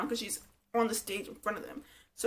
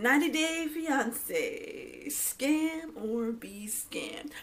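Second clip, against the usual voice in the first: slow, drawn-out title read; mock announcer; 4 semitones higher, much slower than usual. Closing the recap with the show title, mock announcer. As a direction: stretch the title like a TV promo.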